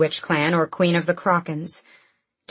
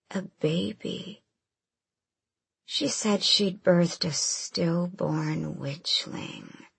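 very slow cruel monster whisper; creepy creature whisper; 7 dB quieter, much slower than usual. A narrator's reading. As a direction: savor each word like poison. Bloodhound reveals cruel news.

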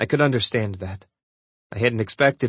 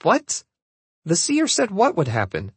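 high-pitched, startled demand; sharp, incredulous questioning; 5 semitones higher than usual. A narrator's reading. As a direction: demand an answer, voice jumping up. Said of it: "Jenna demanded".

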